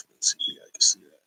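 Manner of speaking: low whisper while fiddling with the camera; hushed aside to viewers. Real, not acted. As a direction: murmur it to yourself while holding up the book. Muttering while adjusting comic to camera.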